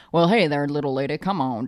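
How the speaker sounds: low Southern crooner impression. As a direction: slide down low, playing the charming singer.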